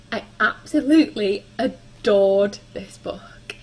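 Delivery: gushing. Real, not acted. Gushing about a favourite book.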